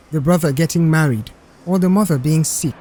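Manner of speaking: plain reading voice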